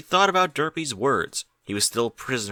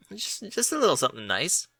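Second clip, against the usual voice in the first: sheepish; 7 semitones higher, 4 dB quieter than usual. Acted. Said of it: "replied Bucky sheepishly".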